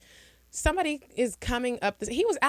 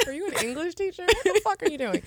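high, quick baffled retort; mock outrage; 9 semitones higher than usual. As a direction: fire it back high and baffled. Mocking response to weird talk.

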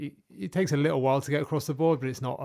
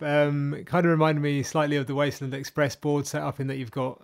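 ordinary delivery

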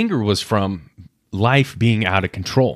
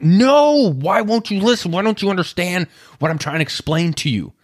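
put-on frustrated toddler; 9 semitones higher than usual. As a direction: pitch way up like an upset little kid who isn't understood. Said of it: "they just get frustrated with you".

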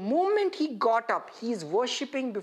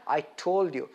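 mock reproach; 7 semitones lower than usual. Imagined I-told-you-so from Jesus.

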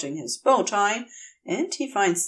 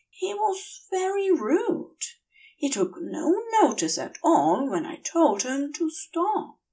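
gentle fussy angel; 7 semitones higher than usual. A narrator's reading. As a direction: voice him higher, earnestly sorry. Aziraphale complaining.

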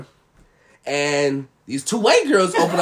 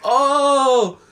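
slow mock wonder; 5 semitones higher, 4 dB louder, much slower than usual. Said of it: "we were like".

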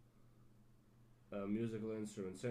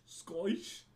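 higher-pitched, slurred mock Scottish; mock drunken Scottish accent; 6 semitones higher than usual. Shown as a demonstration. Podcaster showing how Scottish English sounds drunk, in a mock Scottish accent. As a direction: slur it like a tipsy Scot, rising at the end. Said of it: "it just sounds more drunk".

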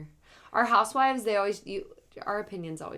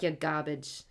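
put-on Jersey accent; 2 semitones lower, slower than usual. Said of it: "that's a good accent".

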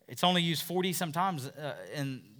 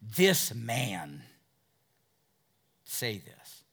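derogatory sneer; 6 semitones lower, slower than usual. Retold or imitated. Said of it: "you can picture him going".

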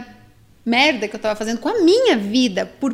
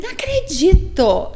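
Não acredito said slow, higher and louder, falling at the end; a slow, stunned Não acredito, pitched up; stunned disbelief; 6 semitones higher, 3 dB louder, slower than usual. Retold or imitated. Wife finding the hidden chip on the bed, stunned. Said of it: "eu só fiz assim".

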